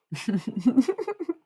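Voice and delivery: high-pitched rippling laugh; high giggle. Real, not acted. After teasing a departing viewer, high giggle.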